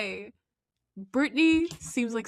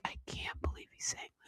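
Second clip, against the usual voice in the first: hushed whisper of disbelief; whispered aside; 11 dB quieter than usual. Real, not acted. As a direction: breathe it out, scandalised.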